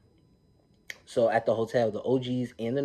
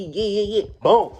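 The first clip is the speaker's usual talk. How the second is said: much higher and louder mock taunt; excited fight re-enactment; 11 semitones higher, 11 dB louder, slower than usual. Vlogger re-enacting a castmate landing a blow, excited. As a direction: act out the hit, punching the final word.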